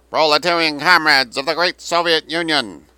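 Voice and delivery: high, loud propaganda proclamation; booming loudspeaker oratory. A narrator's reading. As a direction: boom it across a river to an army. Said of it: "a voice boomed over from the southern bank".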